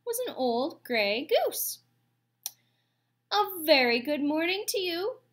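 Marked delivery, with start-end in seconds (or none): 3.3-5.2 high, loud cheery robot greeting; booming friendly voice. A narrator's reading. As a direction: boom it out across the water. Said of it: "the robot's friendly voice boomed over the water".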